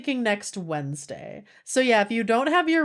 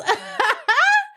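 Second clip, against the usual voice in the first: loud high burst of laughter; 5 dB louder than usual. Real, not acted. Streamer cracking up with chat, loud high laugh.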